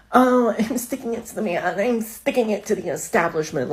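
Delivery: high, smug mocking voice; mock rebel bravado. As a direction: parody a proud tipper defying the system. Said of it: "it's not giving".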